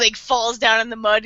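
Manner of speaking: usual speaking voice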